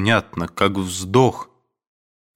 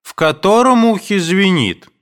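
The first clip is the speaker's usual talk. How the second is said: high, loud calling question; mother's shout; 11 semitones higher, 6 dB louder than usual. A narrator's reading. Mother shouts her question from the next room. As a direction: call it out from afar. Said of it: "закричала вдруг мать".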